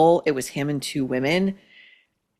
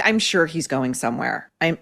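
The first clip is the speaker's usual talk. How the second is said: normal talking voice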